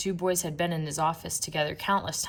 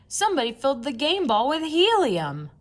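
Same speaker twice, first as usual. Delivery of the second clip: higher, louder fan voice; excited fan shout; 8 semitones higher, 6 dB louder than usual. A narrator's reading. Fan in the bleachers telling everyone, higher loud voice. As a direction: yell the news across the stands. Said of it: "shouted a fan".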